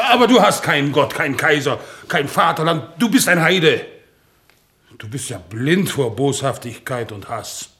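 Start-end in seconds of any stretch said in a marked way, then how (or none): 0.1-3.9 raised, emphatic outburst; indignant accusation. Acted. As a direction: denounce an unbeliever in anger. Accuses comrade in argument. (empört und lautstark anklagend)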